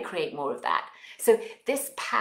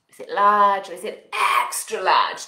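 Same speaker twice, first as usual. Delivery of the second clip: big voice; 8 dB louder than usual. Shown as a demonstration. Sizing feelings, big voice.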